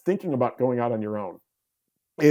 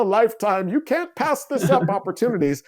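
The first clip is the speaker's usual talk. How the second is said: mock hype; 7 semitones higher than usual. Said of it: "he's like".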